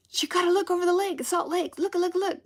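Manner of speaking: put-on high, fast, urgent girl's voice; excited niece. Niece in the car, pointing out the lake, high and urgent. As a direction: squeal it out like an eager kid pointing. Niece urging her to look.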